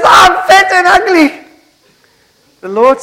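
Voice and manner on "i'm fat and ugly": high, loud, wailing self-pity, stretched at the end; tearful wife. Acted. Wife crying to her husband before the party, hammed-up wail. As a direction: sob it out over the mirror, hammed-up. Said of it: "She says".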